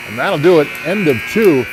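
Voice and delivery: excited. Real, not acted. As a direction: sign off the period with a lift. Period ends, relieved call.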